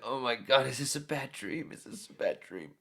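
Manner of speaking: high, hushed mock disbelief; a hint of stunned horror; imitating disbelief. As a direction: act like it's a nightmare.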